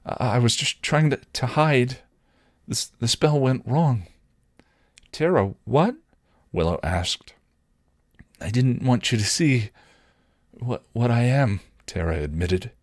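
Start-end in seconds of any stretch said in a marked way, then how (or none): 0.1-4.1 higher-pitched, halting nervous explanation; stuttering. A narrator's reading. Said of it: "she couldn't stop stuttering". 5.1-6.0 higher-pitched puzzled question; confused and worried. A narrator's reading. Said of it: "confused and worried". 8.4-11.6 mildly nervous, halting admission; trembling. A narrator's reading.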